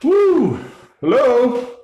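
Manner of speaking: high, drawn-out holler into the house; calling out. Real, not acted. Entering the empty building, announcing "I'm home", calling out. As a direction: sing out a loud, high hello.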